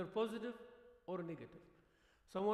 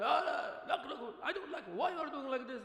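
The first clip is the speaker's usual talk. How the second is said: higher, louder, laughing imitation of a whiner; laughing mock complaint; 6 semitones higher, 4 dB louder than usual. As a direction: poke fun at the complainer.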